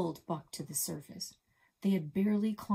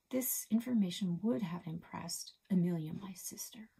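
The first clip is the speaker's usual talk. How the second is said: usual speaking voice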